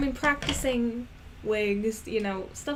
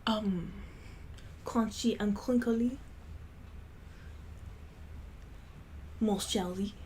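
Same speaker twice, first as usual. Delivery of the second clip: mock French accent; 3 dB quieter than usual. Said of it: "messing up the accents".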